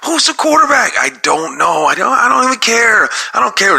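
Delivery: frustrated fan ranting; upset rant. Real, not acted. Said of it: "I know I'm getting upset".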